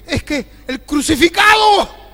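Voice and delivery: high, loud, ecstatic cry; fervent shout. Acted. Paul answering the amazed crowd, shouting. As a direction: proclaim it like a man possessed by faith.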